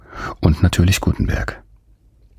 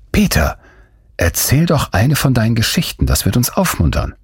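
put-on a lightly higher young girl coaxing; Anna, pleading; 8 semitones higher than usual. Girl begging Peter for a story, lightly pleading. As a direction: ask him sweetly for a story.

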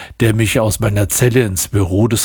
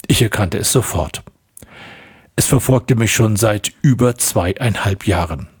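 ordinary delivery, nothing marked

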